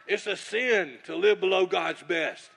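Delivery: imitating Bob, loud and drawn out slowly; slow loud preaching. Bob repeating his rebuke across the table, slow and loud. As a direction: lean on each word of the rebuke. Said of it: "he's talking like that".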